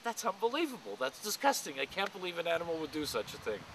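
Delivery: high, appalled exclamation; mock outrage. Dad feigning shock to his son at the zoo, high appalled voice. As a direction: sound scandalized at the baboon.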